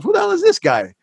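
high, mock-baffled inner voice; incredulous inner thought. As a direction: play up the disbelief at the new guy. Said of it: "I'm thinking to myself".

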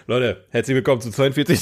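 usual speaking voice

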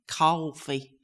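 New York "coffee" with a long aw; attempted American accent. Shown as a demonstration. Actress recalling her stage line, American "coffee". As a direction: say "coffee" as a put-on New Yorker. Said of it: "that's an American face".

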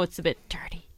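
a cheeky whisper. Real, not acted. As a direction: whisper it like a secret. Cheeky aside about dirty pizza.

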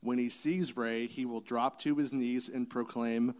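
ordinary talk

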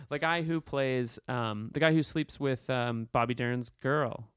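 ordinary delivery

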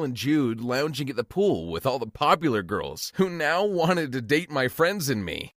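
ordinary delivery, nothing marked

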